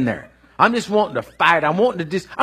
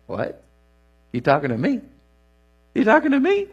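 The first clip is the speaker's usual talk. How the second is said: incredulous Gideon; 3 semitones higher than usual. Acted. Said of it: "And Gideon's like".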